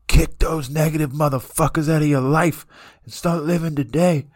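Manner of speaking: imitating a comedian's rant hitting full blast; fired-up rant. Retelling a comic's motivational rant, loud fired-up yell. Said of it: "his energy just amplifies and amplifies".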